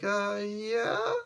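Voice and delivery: high, drawn-out, wobbly yeah; unconvincing reassurance. Acted. Shaken Surfer answering Galactus, mock unconvincing reassurance.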